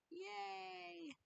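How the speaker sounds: high, very soft, drawn-out cheer; a little squeaked celebration; tiny cheer. Real, not acted. Streamer left waiting for caller to join, tiny high cheer.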